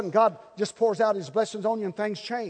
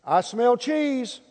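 slow drawn-out catchphrase; 3 semitones higher, much slower than usual. Pretend. Drawn-out punchline.

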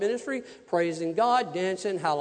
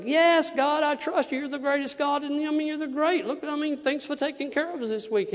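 gushing praise; 8 semitones higher than usual. Retold or imitated. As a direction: gush happily, not really listening.

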